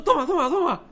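high, loud insistent "toma"; insistent offer. Retold or imitated. Preacher retells handing back the change, high and loud. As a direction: push the money back eagerly.

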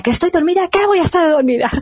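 high, offended denial; indignant sleepwalker. Retold or imitated. Sleepwalking sister snapping at her father, high indignant voice.

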